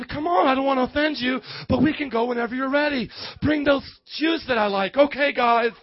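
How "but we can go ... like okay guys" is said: ordinary talk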